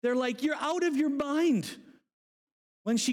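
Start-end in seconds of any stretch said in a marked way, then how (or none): 0.4-1.8 high-pitched mock disbelief; incredulous dismissal. Believers doubting Rhoda, incredulous. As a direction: scoff at her news, pitched up.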